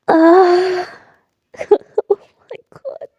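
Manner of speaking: laughing and crying at once; overjoyed sob-laugh; joyful laughing cry. Acted. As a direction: burst into happy tears and laughter. Character overwhelmed with joy.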